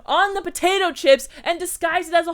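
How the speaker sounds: high, loud mock tirade; mock furious rant. Ranting at Cameron about bath sheets and chips, loud mock fury. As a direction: unload on him, hammed-up and loud. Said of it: "I'd be like".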